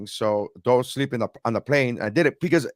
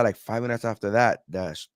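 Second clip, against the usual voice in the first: ordinary delivery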